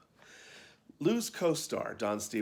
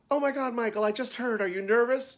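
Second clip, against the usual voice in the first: high, loud excited chirp; chirpy chorus girl; 13 semitones higher, 7 dB louder than usual. A narrator's reading. Chorus girl to the new lead before the show, chirping excitedly. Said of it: "chirped one of our chorus girls".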